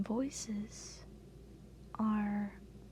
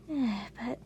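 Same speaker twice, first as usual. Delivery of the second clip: usual speaking voice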